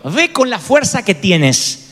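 slow, steady sending; slow firm command. Retold or imitated. God slowly sending a fearful Gedeón to fight. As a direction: say it slowly, each word weighed. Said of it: "sino la anterior".

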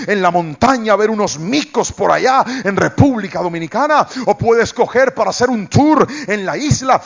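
lightly voiced high sales lady; gushing woman. Resort lady offering gifts, mildly high eager voice. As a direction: chatter on like an eager hostess.

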